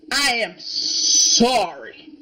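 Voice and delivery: "I am sorry" drawn out slowly, each word stretched; slow mock apology. Shown as a demonstration.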